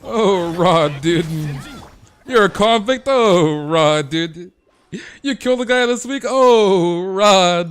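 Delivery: loud, high, hammed-up swoon; mock girls thrilled by a bad boy, belting it out. Streamer imitating girls who want bad boys, loud mock swooning. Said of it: "All the girls want the frickin' bad boys".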